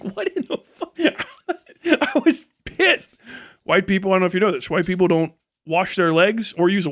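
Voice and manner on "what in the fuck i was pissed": high squeaky laugh, slow; outraged but laughing; laughing mock outrage. Retelling shock at commenters, laughing high. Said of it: "I was like".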